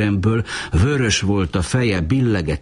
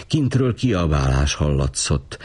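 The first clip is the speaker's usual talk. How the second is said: usual speaking voice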